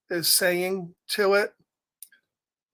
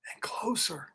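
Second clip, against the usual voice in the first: whisper; 11 dB quieter than usual. A narrator's reading. Rabbit creeping closer.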